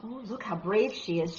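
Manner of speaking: ordinary delivery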